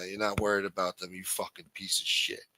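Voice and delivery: ordinary delivery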